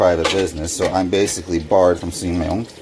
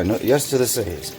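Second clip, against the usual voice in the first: ordinary talk, nothing marked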